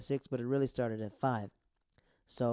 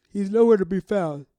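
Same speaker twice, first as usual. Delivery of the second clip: much louder, higher, carrying voice; exasperated loud outburst; 7 semitones higher, 15 dB louder than usual. Real, not acted. Recapper complains that Skills is missing, loud and exasperated. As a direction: call it out in exasperation.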